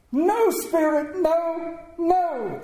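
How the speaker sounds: high, drawn-out cry of refusal; terrified old miser begging; desperate pleading. A narrator's reading. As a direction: plead for mercy from a spirit. Scrooge begs the ghost.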